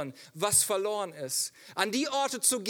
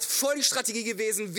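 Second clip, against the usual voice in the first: normal talking voice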